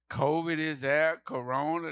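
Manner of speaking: ordinary talk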